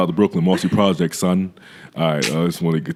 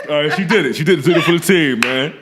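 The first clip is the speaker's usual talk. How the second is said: laughing; 8 semitones higher, faster than usual. Real, not acted. Laughing at guest's bark.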